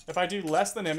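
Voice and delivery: normal talking voice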